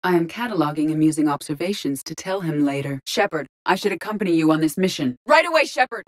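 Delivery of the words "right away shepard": high, eager response to an order; urgent acknowledgement. Acted. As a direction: answer the commander urgently.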